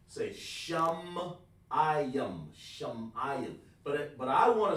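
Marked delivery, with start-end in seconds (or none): 0.1-3.6 "Shum-I-yum" drawn out very slowly, each syllable apart; syllable split. Shown as a demonstration. Teacher sounding out the Hebrew word syllable by syllable, very slow. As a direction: stretch "Shum-I-yum" into three slow parts. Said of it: "then I would say Yum".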